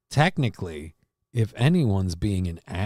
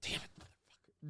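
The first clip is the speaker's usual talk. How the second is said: hushed unvoiced swearing; whispered curse; 24 dB quieter than usual. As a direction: curse under your breath in a whisper. Whispered curse after skit.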